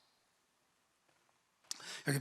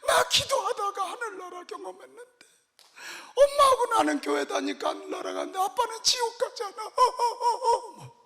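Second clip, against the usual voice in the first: put-on crying child; 6 dB louder than usual. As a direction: plead like a small child crying for dad. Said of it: "막 이래가면 우는 거 있잖아요". (아빠를 붙잡고 우는 아이처럼 높고 느리게 울먹이며)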